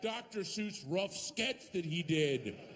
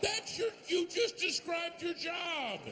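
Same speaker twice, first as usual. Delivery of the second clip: exasperated shout. Real, not acted. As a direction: yell it out, baffled and fed up. Exasperated punchline at the cop.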